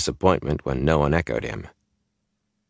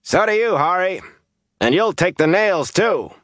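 high-pitched heckle from a crowd; loud-voiced, high jeering retort; shouting; 13 semitones higher, 3 dB louder than usual. A narrator's reading. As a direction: shout a taunting comeback over everyone. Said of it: "someone in the crowd shouted".

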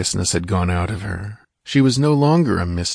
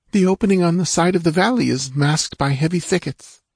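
put-on high, anxious girl's voice; frightened dancing girl; 10 semitones higher than usual. A narrator's reading. Captive girl explains to Conan, high and anxious. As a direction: tell it quickly, still scared.

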